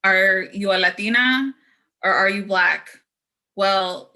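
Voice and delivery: ordinary talk, nothing marked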